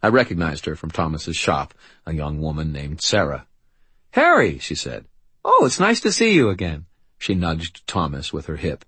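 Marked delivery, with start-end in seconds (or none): 4.1-5.1 much higher, louder greeting; delighted young woman. A narrator's reading. Young woman greets Harry, high delighted voice. 5.5-6.9 high, louder friendly greeting; delighted young woman. A narrator's reading. Young woman sees Harry again, high delighted voice.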